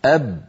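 أب stopped hard at the lips; held b demo. Shown as a demonstration. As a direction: trap the sound on أب. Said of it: "انحصر الصوت خلفهما". (باء محبوسة)

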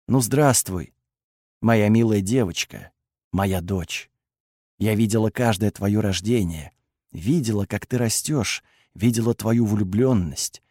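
low, gentle, unhurried goddess; tender motherly greeting. Acted. Goddess tenderly greets her daughter.

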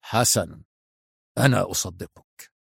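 low, soft cruel mockery; quiet sardonic killer; savage sarcasm. A narrator's reading. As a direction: pretend to believe him, softly menacing. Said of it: "في سخرية وحشية".

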